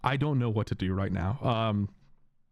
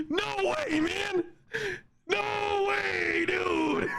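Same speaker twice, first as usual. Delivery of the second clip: incredulous squeal; much slower than usual. Real, not acted. Streamer ranting at himself.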